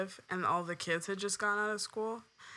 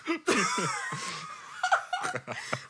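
high giggling fit, almost tearful; laughter breaking through; stifled giggles; 12 semitones higher than usual. Real, not acted. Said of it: "Try not to laugh".

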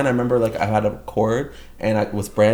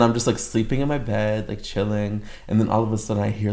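usual speaking voice

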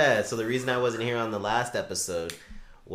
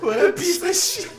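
disgusted; 3 dB louder, slower than usual. Real, not acted. Outraged reaction to cats.

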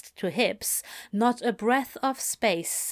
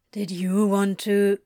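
muffled between kisses; 5 dB louder than usual. A narrator's reading. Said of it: "muffled between kisses".

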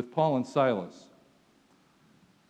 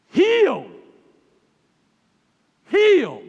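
shrill high, loud triumphant scream; two full-throated shouts of healed; 13 dB louder than usual. Acted. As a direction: scream it out like a fleeing disease. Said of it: "probably left screaming".

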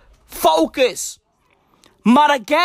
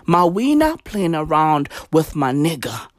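ordinary talk, nothing marked

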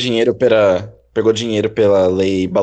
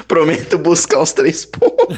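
rindo; 7 semitones higher, 3 dB louder than usual. Pretend. Jura de brincadeira rindo.

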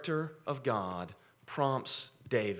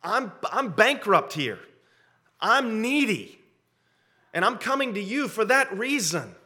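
lightly voiced plea, higher pitched; earnest needy prayer; 8 semitones higher, 3 dB louder than usual. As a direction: plead your need to God in a raised voice. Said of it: "But the prayer of".

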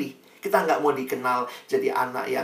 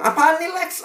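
much higher, nosy teasing question; curious friend; 4 dB louder than usual. Retold or imitated. Friend finding the Bible on the desk, nosy high question. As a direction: poke at him like a curious roommate.

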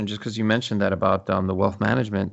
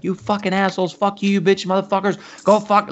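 retold cursing tirade, higher pitched and escalating; crazy swearing rant; 9 semitones higher than usual. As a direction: lose it at the scammers, building to a shout. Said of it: "Like, I went all crazy".

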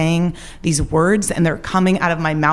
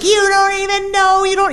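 put-on much higher, panicky boyfriend; mock freak-out; 3 dB louder than usual. Boyfriend panicking as she says she's leaving, high frantic squeal. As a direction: squeak it out like a man losing it. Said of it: "he's just freaking out".

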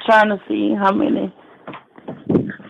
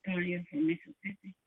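faint, distant speakerphone caller; 17 dB quieter than usual. Real, not acted. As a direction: caller on speakerphone, far from the mic. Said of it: "Take me off speakerphone so I can hear you".

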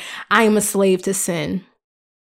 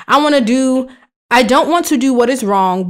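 usual speaking voice

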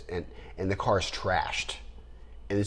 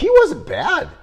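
excited; 10 dB louder, slower than usual. Real, not acted. Emphatic squeaky agreement.

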